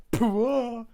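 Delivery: high, loud pained pop; mock impact cry. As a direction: blurt a comic hit sound. Hitting himself with hammer.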